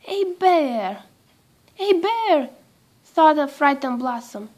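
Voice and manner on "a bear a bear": alarmed shout. A narrator's reading. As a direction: call out the warning to the whole crowd. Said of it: "someone suddenly shouted".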